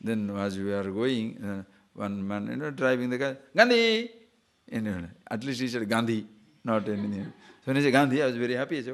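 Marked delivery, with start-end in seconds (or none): 3.6-4.1 called out loud and high from a passing car; shouted call. Retold or imitated. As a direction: yell the name at a stranger in the street.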